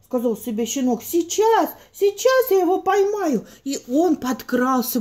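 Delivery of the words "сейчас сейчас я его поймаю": excited. Acted. Puppy excited to catch.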